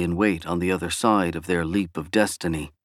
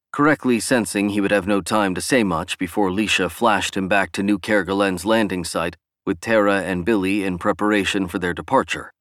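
ordinary delivery, nothing marked

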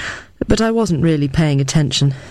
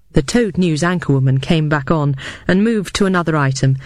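plain reading voice

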